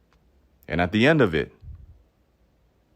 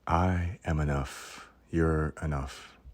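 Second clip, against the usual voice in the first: mock-sincere affirmation; 5 dB quieter, slower than usual. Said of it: "You really sound like, um, condescending".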